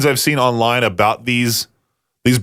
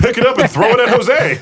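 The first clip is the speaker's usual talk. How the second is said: higher and laughing, mocking his friend; 9 semitones higher than usual. Voicing his friend while laughing. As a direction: giggle through the line.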